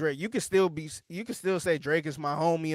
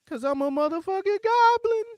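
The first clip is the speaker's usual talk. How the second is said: high squeaky rapper voice, much higher and louder; 7 dB louder than usual. Retold or imitated. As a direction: mimic a raspy rapper on a hook. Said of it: "then there's [name] on the hook".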